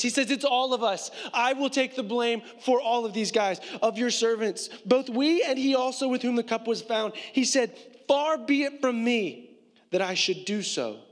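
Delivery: loud, high, driving sermon voice; full-force preaching. Acted. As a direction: preach it out to the whole room.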